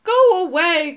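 loud, high, sorrowful monster cry; werewolf's cry. A narrator's reading. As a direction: cry out as a weeping werewolf warning people off. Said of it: "cried the wo-, voice".

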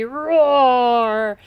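loud, long drawn-out roar; booming, slow storybook roar; roaring word. A narrator's reading. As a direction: stretch the word into a mighty roar. Narrator acts out the dragon's roar.

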